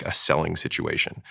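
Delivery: ordinary delivery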